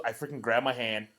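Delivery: ordinary delivery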